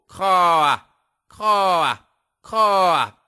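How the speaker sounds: high loud cawing; crow caw. Acted. Crow mocking the woodcutter, loud cawing.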